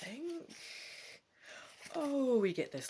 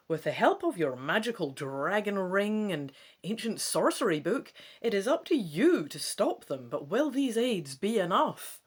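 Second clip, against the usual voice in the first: louder, punchy trailer read; dramatic blurb reading; 5 dB louder than usual. Acted. Gamer reading the blurb to viewers, dramatic trailer read. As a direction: sell the adventure like an ad. Reads back-cover blurb aloud.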